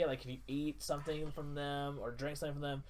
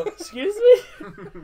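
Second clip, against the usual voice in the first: very high, loud, slow Excuse me; stretched outraged disbelief, laughing; indignant surprise; 17 dB louder, much slower than usual. Retold or imitated. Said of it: "you kinda watch her go".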